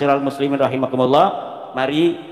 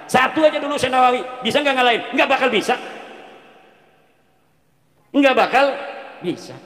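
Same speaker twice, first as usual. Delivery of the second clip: heated rhetorical challenge; 7 semitones higher, faster than usual. Real, not acted. Preacher mocks challengers, raised voice.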